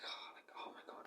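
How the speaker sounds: breathless whispered gush, barely voiced; awed whisper. Girl whispering in awe at his confidence, hushed and breathless. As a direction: whisper it like she's dazzled. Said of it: "And she's like".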